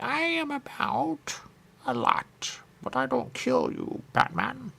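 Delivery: high, slow squeaky villain impression; penguin voice. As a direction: stretch it out like a cartoon penguin. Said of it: "You could do voice work".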